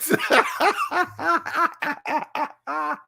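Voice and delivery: big laugh. Real, not acted. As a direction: let the laugh burst out. Laughs after outburst.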